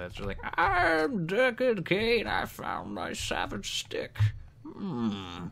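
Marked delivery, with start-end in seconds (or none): 0.6-2.3 high, slow old storyteller impression; Deckard Cain voice. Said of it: "I can do the Deckard Cain voice". 2.3-5.5 slow, soft old-sage impression; a hint of a slow, musing old sage; Deckard Cain voice. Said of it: "the Deckard Cain voice".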